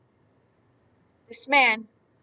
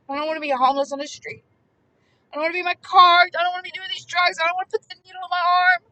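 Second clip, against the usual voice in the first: 1 desperate pleading; 7 semitones higher, faster than usual. Acted. Voicing the tormented man's plea.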